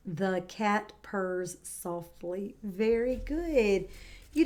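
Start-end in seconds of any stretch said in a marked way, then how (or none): 0.1-2.6 slow and deliberate, word by word; slow model sentence. Shown as a demonstration. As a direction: say it slowly for copying. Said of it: "Add softly after purrs".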